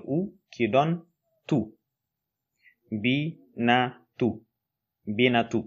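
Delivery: binatu built syllable by syllable: bi, na, tu. Shown as a demonstration. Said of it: "Pour le transcrire en arabe".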